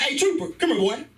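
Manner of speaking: high, coaxing call; mock dog-summoning voice. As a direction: call the boy over as you would a pet. Calls the kid like a dog.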